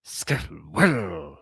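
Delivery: drawn-out mock-British "Squirrel"; British accent. Imitating British teacher doing it once, drawn-out British "Squirrel". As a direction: say "Squirrel" slowly with careful, put-on British vowels. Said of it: "she was British".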